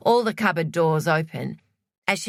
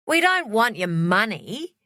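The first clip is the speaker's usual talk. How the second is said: disgusted; 5 semitones higher than usual. A narrator's reading.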